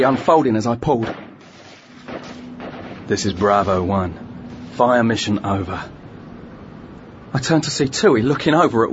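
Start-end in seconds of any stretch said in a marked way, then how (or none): 3.1-5.8 lower, level radio voice; calm radio call for fire. Acted. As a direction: call it in steady over the net.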